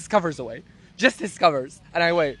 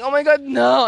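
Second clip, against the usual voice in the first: higher, louder retold protest; put-on "oh no" outcry; mock dismay; 5 semitones higher, 5 dB louder than usual. Retells mock protest at work.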